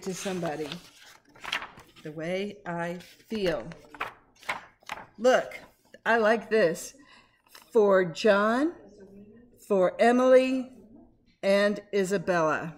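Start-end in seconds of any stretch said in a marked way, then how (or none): none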